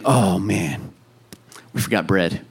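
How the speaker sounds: low, soft, deflated disciples' moan; mopey grumble. Retold or imitated. Disciples grumbling about forgotten bread, low mopey moan. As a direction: sigh it out like a let-down disciple. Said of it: "They're like".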